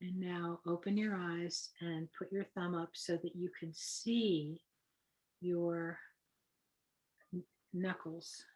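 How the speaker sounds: softly spoken breathing-exercise guide; guided meditation. Acted. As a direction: lead them gently into the breathing, unhurried.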